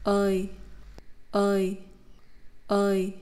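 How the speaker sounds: "Ơi" gliding from ơ to ê; Vietnamese diphthong ơi. Shown as a demonstration. Teacher drilling ơi for learners, careful and clear. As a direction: repeat "ơi" clearly three times. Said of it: "move your mouth to the position of the vowel ê".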